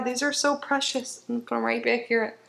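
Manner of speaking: usual speaking voice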